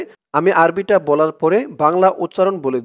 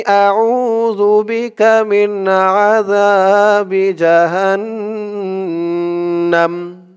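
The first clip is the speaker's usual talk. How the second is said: slow, drawn-out recited Arabic; model recitation; 3 semitones higher, 3 dB louder, much slower than usual. Shown as a demonstration. Teacher models the word for viewers to repeat, slow recitation. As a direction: stretch every syllable for them to copy. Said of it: "তো আমরা থেমেই পড়ব".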